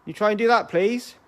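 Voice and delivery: high, loud coaxing call; talking to a ghost. Real, not acted. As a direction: urge the spirit to try. Calling out to a spirit.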